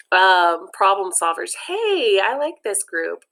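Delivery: plain reading voice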